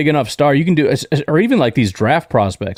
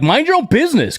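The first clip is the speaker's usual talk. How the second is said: mock snappy retort; 11 semitones higher than usual. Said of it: "And you're like".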